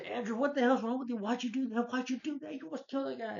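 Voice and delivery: furious friend shouting at Andrew; angry yelling. Retold or imitated. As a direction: yell at him like he nearly killed someone. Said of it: "And I say".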